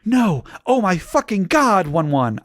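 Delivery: high, frightened cry waking from a nightmare; scared shout. A narrator's reading. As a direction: yell out of the bad dream. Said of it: "I shouted in a scared voice".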